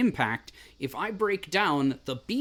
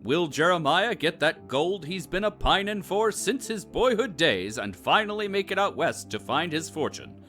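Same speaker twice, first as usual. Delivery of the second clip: dramatic announcer. Acted. Announcer closes the episode.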